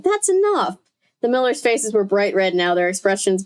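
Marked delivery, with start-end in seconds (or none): 0.0-0.8 high sharp scold; a mother stopping her daughter's insults, pitched high. A narrator's reading. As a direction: cut her off firmly.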